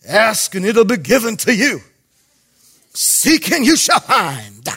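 lightly hamming up a televangelist; mock prosperity preacher. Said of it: "why the prosperity people have to talk like that".

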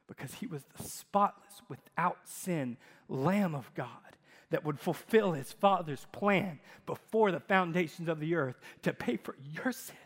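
ordinary talk, nothing marked

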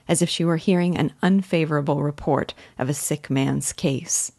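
usual speaking voice

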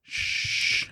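imitated hushing "shh"; soft, long shush. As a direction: hush someone secretively. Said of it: "and he goes".

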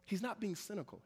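ordinary talk

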